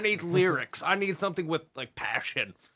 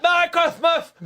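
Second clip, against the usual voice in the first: high, loud strangled voice; swallowed voice; 14 semitones higher, 8 dB louder than usual. Pretend. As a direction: squeeze it out like you swallowed a pigeon. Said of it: "sound like you swallowed a pigeon".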